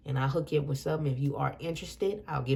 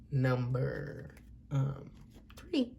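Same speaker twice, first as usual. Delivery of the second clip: softer, trailing "number, um, three"; voice got low; 7 dB quieter, much slower than usual. Real, not acted. Reader introducing the group to viewers, voice dropping low. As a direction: let the voice sink away mid-announcement. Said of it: "it's like my voice got low in it".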